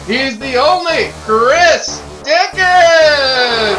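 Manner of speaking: loud, high, slow ring introduction; announcer hype call. Acted. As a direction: stretch out the guest's name for the crowd.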